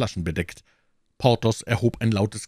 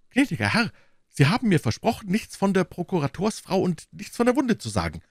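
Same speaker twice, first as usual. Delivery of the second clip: high, fretful innkeeper voice, wringing his hands; anxious innkeeper; 10 semitones higher than usual. Acted. Innkeeper pleading with D'Artagnan, high and anxious. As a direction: play the worried host complaining.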